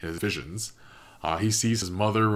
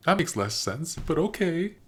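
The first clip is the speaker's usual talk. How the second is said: higher, dry, skeptical shrug; unconvinced Rayla; 9 semitones higher than usual. Retold or imitated. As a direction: go along with it, clearly doubting. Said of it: "And she's like".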